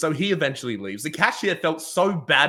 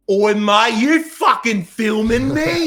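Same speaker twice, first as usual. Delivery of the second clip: put-on higher, louder, slow drunk Aussie challenge; confused drunk bloke; 10 semitones higher, 9 dB louder, slower than usual. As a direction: call out like a baffled drunk giant. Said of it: "And he goes".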